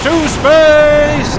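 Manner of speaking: high, very drawn-out hero's call; hammed-up heroic cry, pitched high; triumphant battle cry. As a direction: call it out like a launch, stretching it long. Cheering the jet upward.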